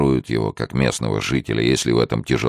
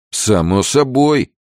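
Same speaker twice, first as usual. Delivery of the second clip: louder, higher, drawn-out assent; eager agreement; 12 semitones higher, 5 dB louder, slower than usual. Acted. Rafael backs down before Pedro, eager agreement. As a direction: agree readily, voice raised. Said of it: "поспешил согласиться Рафаэль".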